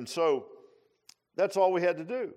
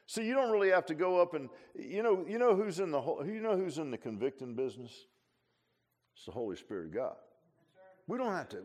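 ordinary delivery

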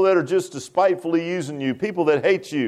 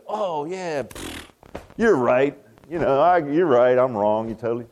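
low, deflated pretend admission; mock meek concession; 6 semitones lower than usual. Spouse conceding a heated debate, mock meek. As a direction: act out an unlikely climb-down.

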